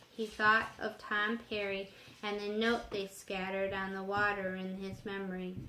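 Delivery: ordinary delivery, nothing marked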